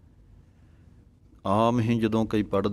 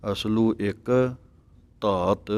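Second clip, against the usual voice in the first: ਅਸਲੂ ਇੱਕ, brief dash, ਧਾਤ; slight separating pause; slower than usual. Shown as a demonstration. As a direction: pause after ਇੱਕ, then ਧਾਤ on its own. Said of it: "ਥੋੜ੍ਹਾ ਜਿਹਾ dash ਦੇ ਕੇ ਧਾਤ ਬੋਲਣਾ ਹੈ ਵੱਖਰਾ".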